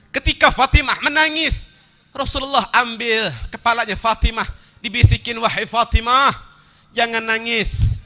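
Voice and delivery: urgent preacher's storytelling; impassioned preaching. Acted. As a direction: press the story on the congregation. Preacher raises voice for climax.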